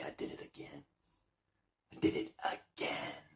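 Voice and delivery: smug whispered boast; gloating whisper. Retold or imitated. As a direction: hiss it like a villain who got away with it. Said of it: "piss themselves laughing and say".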